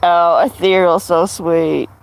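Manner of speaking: ordinary delivery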